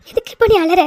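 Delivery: ordinary talk, nothing marked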